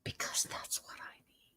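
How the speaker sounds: faint, voiceless whisper. Real, not acted. Streamer alone wanting a cigarette, whispering. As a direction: whisper it under your breath. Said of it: "I'm whispering".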